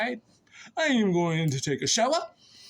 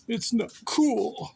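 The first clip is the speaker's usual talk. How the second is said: slow, higher complaint with a long "cool"; drawn-out disapproval; 5 semitones higher, slower than usual. Real, not acted. Telling a missed friend it's not cool, drawn-out. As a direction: stretch out COOL slowly.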